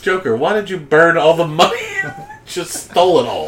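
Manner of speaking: lightly acted high-pitched protest to a partner; Danny Ocean. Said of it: "Danny Ocean being like".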